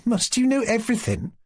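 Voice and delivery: laughing. A narrator's reading. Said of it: "said Marianka, laughing".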